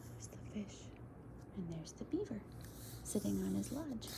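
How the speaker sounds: soft whisper pointing things out. Real, not acted. Showing the children the picture, whispering.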